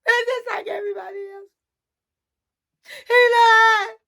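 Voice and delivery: high sobbing wail, words tumbling out. Retold or imitated. Faith wailing to friends about her lying boyfriend, sobbing high. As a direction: cry it out, voice pitched high.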